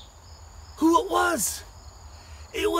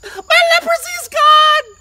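much higher, louder joyful shout; 13 semitones higher, 12 dB louder, slower than usual. Acted. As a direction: cry out high with joy. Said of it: "the one that sang really high".

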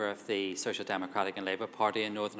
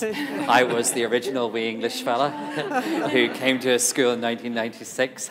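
talking through real laughter, louder; laughing; 3 semitones higher, 9 dB louder than usual. Real, not acted. Panel guest laughing about being the wee English fella, laughing. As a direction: chuckle as you tell on yourself. Derry Girls joke, speaker laughing.

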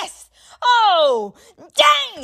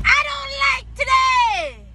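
frustrated shout; 7 semitones higher, slower than usual. Acted. Kid's recurring frustrated outburst.

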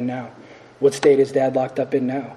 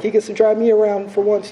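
higher-pitched, retold excitement; excited girlfriend; 8 semitones higher than usual. Girlfriend hopping into the car for first drive, retold excitement. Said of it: "she's all excited".

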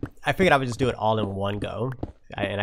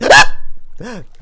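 sharp, loud frightened gasp; startled gasp; 10 semitones higher, 17 dB louder than usual. Real, not acted. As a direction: gasp hard as if a creeper jumped you. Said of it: "that scared the crap out".

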